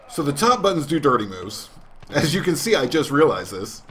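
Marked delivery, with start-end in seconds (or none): none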